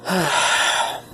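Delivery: loud unvoiced exhale of satisfaction; long sigh. The madman pauses his tale with a heavy sigh. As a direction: let out a long gloating sigh.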